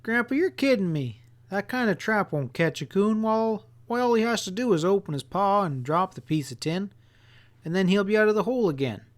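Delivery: put-on high, upset boy near tears; a boy close to tears, feeling fooled; almost crying. A narrator's reading. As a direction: blurt it out, hurt and fast at the end. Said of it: "almost cried as I said".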